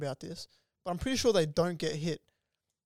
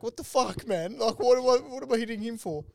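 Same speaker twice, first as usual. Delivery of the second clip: confused dad; 5 semitones higher, 3 dB louder than usual. Retold or imitated. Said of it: "he's just like".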